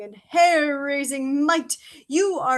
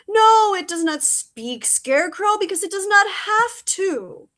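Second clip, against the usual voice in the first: intense insistence; 7 semitones higher, 5 dB louder than usual. Acted. Crow insists on monster's power.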